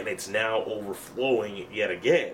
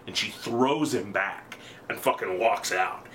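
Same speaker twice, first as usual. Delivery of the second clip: normal talking voice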